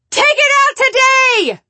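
loud, high, slow furious demand; angry shout. Retold or imitated. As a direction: yell the order at the doctor. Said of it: "because I was mad".

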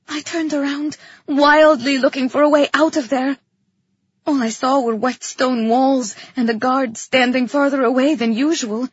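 usual speaking voice

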